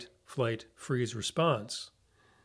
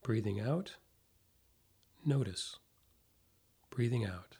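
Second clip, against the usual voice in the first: guided meditation; 4 dB quieter than usual. Acted. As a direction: ease them into calm, softly, with long pauses.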